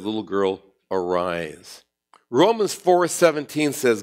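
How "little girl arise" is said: lower, softer, gentle; tender command. Acted. Jesus to the dead girl, softly tender. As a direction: call her gently awake.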